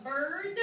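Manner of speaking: "bird" slow and loud, releasing the D; final D emphasized. Shown as a demonstration. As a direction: sound out the D ending of "bird". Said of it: "have a d sound at the end".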